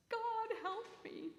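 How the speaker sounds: high, slow, hushed cry to heaven; pleading upward, voice thin with fear; desperate plea. A narrator's reading. Stranded man begging God from a cliff, high and hushed. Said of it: "he cried out to the heavens".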